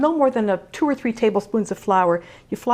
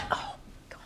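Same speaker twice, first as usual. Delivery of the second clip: breathless whispered awe; 18 dB quieter than usual. Real, not acted. Sees finished bread.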